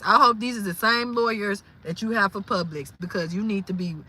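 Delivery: normal talking voice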